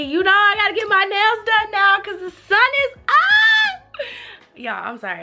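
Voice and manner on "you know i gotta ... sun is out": excited squeal. Real, not acted. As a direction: gush about the sunny day. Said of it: "I'm just so excited".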